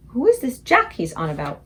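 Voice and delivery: usual speaking voice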